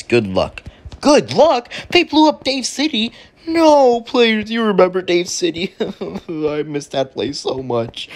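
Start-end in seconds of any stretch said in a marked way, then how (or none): 1.0-1.7 high, loud, disbelieving squawk; hammed-up indignant shock; incredulous outburst. Outraged echo of the note. 1.9-4.5 high-pitched, disbelieving wail; hammed-up dismay. Learns his city was blown up. 4.5-8.1 high wail slowing into fake sobs; hammed-up weepy lament; mock grief. Mourns his lost city.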